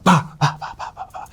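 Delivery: mimicked pounding strides; imitated sprint sounds. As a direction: mouth the bursts of a sprint start. Imitating his second sprint.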